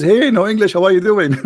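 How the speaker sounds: high, loud, hearty hello; loud cheery greeting. Retold or imitated. Old army mate greeting him by his nickname, loud and high. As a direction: call out like spotting an old pal. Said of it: "but he says".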